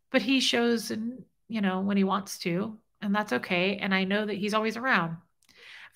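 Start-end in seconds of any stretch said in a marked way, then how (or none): none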